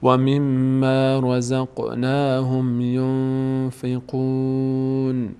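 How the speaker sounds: drawn-out Quranic chant, syllable by syllable; slow recitation. Shown as a demonstration. Teacher recites slowly for students, chanting. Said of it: "teacher recites the full verse".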